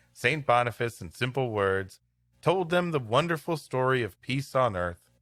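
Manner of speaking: ordinary delivery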